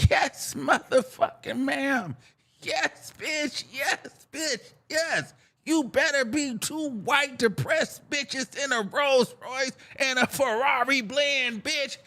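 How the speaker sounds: high, hushed, emphatic approval; hammed-up admiration, savoring each yes; awed hype. Acted awed hype for crying rich women.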